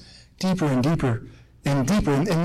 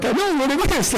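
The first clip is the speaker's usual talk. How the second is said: higher, bursting to answer; eager outburst; 9 semitones higher than usual. Retold or imitated. Viewer wanting to jump through the television screen, eager. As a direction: jump in as if you can't hold back the answer. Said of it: "jump through the television screen and be like".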